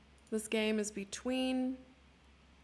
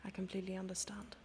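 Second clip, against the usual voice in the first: hushed, quick whispered aside; mock-contrite whisper; 6 dB quieter, faster than usual. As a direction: breathe it out as a secret. Whispered apology to Papa.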